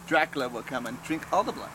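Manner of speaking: low, fast, hushed Dracula warning; spooky warning. Acted. Man warning about blood at the grill, in a low, quick spooky hush. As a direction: tell it like a scary bedtime tale.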